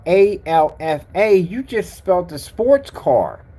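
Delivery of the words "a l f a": slow, loud letter by letter "A L F A"; loud slow spelling of "A L F A". Shown as a demonstration. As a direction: spell each letter of "A L F A" separately. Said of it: "not the alpha".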